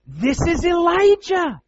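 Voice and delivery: high, slow, loud awed cry; awed exclamation of recognition; excited exclaim. Acted. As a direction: announce him like a thrilling discovery. Disciples' excited realization.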